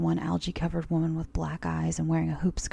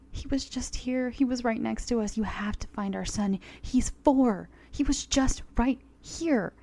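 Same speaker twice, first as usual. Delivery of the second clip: higher, louder desperate plea; begging; 6 semitones higher, 4 dB louder than usual. Acted. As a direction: beg them to find the son. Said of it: "He begged".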